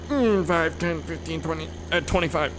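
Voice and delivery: dopey halting arithmetic. Acted. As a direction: play dumb working out a sum. Imitates himself struggling at math.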